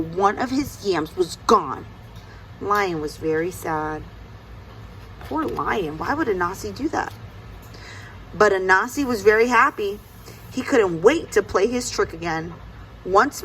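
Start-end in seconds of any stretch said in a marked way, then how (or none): none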